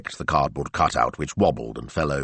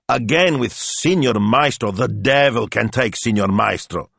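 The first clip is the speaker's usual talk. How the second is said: blustering scoff; 5 semitones higher, 4 dB louder than usual. Acted. Speranza snorts dismissively.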